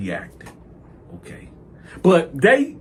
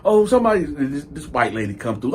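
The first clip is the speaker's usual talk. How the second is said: ordinary talk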